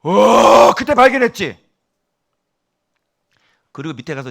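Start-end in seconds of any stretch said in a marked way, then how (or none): 0.0-1.6 loud, high drawn-out "oh" of discovery; high-pitched, loud eureka moment; excited realization. Acted. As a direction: burst out as if it just hit you. Acting out a sudden realisation.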